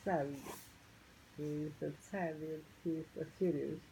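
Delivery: usual speaking voice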